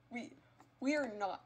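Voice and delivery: ordinary talk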